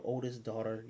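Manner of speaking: ordinary delivery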